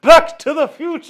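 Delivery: high triumphant exclamation; excited cry. Pretend. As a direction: shout the title like a trailer. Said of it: "And he's like".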